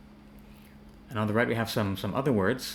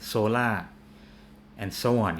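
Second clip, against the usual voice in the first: ordinary talk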